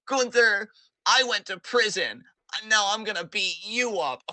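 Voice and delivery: high, loud mock kid voice threatening Gunther; kid's tough-guy threat. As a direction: play a child bragging he went to prison. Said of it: "comes in and is like".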